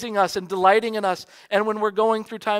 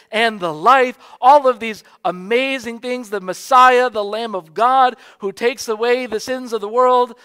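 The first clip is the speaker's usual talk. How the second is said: louder and higher, building through each title; rising fervor; 5 semitones higher, 9 dB louder than usual. Acted. Preacher exalting Jesus' titles to the congregation, fervent. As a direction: swell into full preaching praise.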